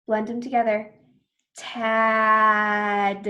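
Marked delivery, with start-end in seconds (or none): 1.6-3.2 really long sounds. Shown as a demonstration. Teacher blends sounds together, Tad really long. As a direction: stretch out Tad loudly. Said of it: "I said the sounds really long".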